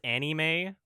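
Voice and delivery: "anime" as AN-ee-may, with a rising end; corrected pronunciation. Shown as a demonstration. Correcting the question reader, pronouncing "anime" AN-ee-may. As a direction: say "anime" with a clear EE in the middle, AN-ee-may. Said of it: "I believe it's pronounced".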